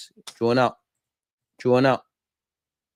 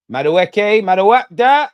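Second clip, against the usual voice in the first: louder, higher call of the name; 6 semitones higher, 10 dB louder, much slower than usual. Real, not acted. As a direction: shout his name across the pitch. Calling out the player's name.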